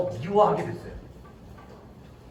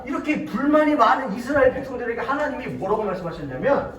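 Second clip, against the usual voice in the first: ordinary talk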